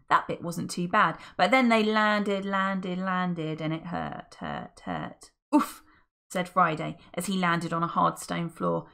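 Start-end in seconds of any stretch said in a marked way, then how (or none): 3.6-5.3 each hurt deeper and softer; sinking lower. A narrator's reading. Narrator describing the painful landing, sinking low. 5.5-5.8 loud grunt; winded oof. Acted. Friday landing on stone, winded grunt.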